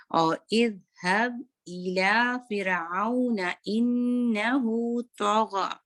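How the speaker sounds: slow chanted recitation; drawn-out Quran recitation. Shown as a demonstration. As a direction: recite it slowly, gha heavy. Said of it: "तो heavy".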